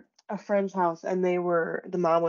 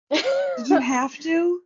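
high, drawn-out mock complaint; mock exasperated protest; 9 semitones higher, 4 dB louder, much slower than usual. Moviegoer complaining to the film about its scares, mock exasperated. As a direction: plead slowly, pitched way up, in mock protest.

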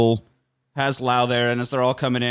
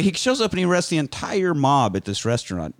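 ordinary delivery

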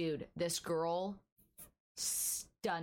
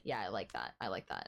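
low approving voice; 10 semitones lower, faster than usual. Retold or imitated. Girlfriend agreeing to the plan.

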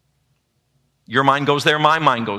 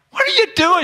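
much higher and louder, squeaky; squeaky high protest; 4 dB louder than usual. Retold or imitated. Husband catching wife changing the front door code, squeaky high yelp. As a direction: yelp it up high in mock alarm. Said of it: "and I'm like".

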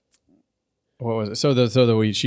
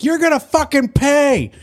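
much higher and louder snarl; mock furious threat; 4 dB louder than usual. Host voices Fluttershy leaping to attack, loud high threat. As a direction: lunge at her with a cartoon war cry. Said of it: "She's like".

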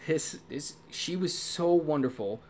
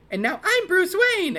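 much higher, loud hoity-toity voice; hoity-toity Bruce Wayne; 7 dB louder than usual. Retold or imitated. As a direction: lift into a snooty high register. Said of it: "hoity-toity, little more high-pitched voice for Bruce Wayne".

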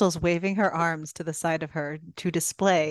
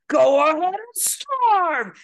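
loud roaring movie-monster impression, pitched up; the Beast; 11 semitones higher, 6 dB louder, slower than usual. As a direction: bellow it slowly like a raging beast. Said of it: "full Beauty and the Beast on her".

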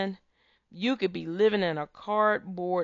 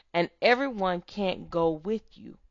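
normal talking voice

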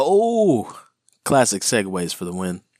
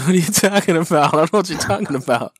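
laughing; 8 semitones higher, 4 dB louder, faster than usual. Real, not acted. Laughing denial after being teased.

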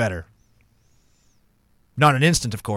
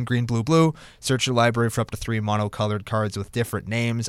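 ordinary talk, nothing marked

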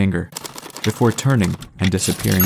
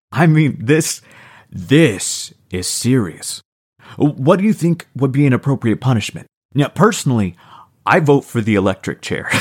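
sarcastic; 5 semitones higher, 4 dB louder than usual. A narrator's reading. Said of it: "Max sarcastically faked a look of concern".

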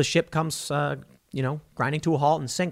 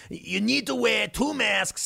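slow, higher mocking impression of Fauci; 6 semitones higher, slower than usual. Mocking Fauci telling the public to wear masks. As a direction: drag out the order like a preachy official. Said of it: "Fauci can come out and be like".

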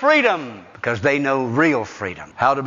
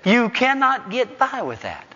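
rising, ringing pulpit cadence, each line pushed higher; preaching at full force; 11 semitones higher than usual. Acted. Preaching high.

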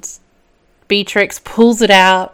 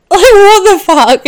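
high, loud cry of disbelief; shocked outburst; 12 semitones higher, 6 dB louder than usual. Real, not acted. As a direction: blurt it out in real shock. Reacting to the eye-gouge scene.

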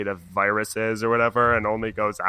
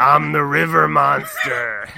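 loud, raised mock monster yell; hammed-up monster announcing itself; river monster; 5 semitones higher, 7 dB louder than usual. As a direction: roar it out like a goofy river monster. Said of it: "and been like".

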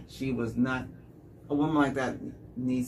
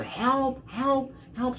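high mock screams for help; retold screams of help, much higher; imitated screaming; 11 semitones higher, much slower than usual. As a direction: shriek help out the window. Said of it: "She started screaming out the window".

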